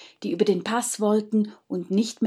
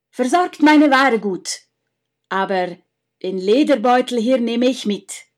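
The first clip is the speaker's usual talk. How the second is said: commanding count; 3 semitones higher, 7 dB louder than usual. Acted. Count instructing innkeeper brothers.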